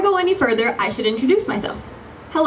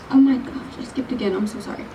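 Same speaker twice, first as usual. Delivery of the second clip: embarrassed whisper; 3 dB quieter than usual. Real, not acted. Realizes skipped lines.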